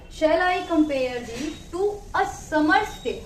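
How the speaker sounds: very slow "Shall I compare thee to a summer's day?", each iambic foot set apart; scanned verse reading. Shown as a demonstration. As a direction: read "Shall I compare thee to a summer's day?" slowly, leaning on every second syllable. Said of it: "poem की line है ये ऐसे है".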